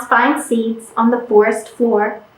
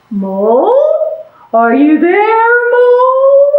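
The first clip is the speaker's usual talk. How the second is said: calling out; 8 semitones higher than usual. Acted. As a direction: call out, rising on the last name. Bear calls for Mole.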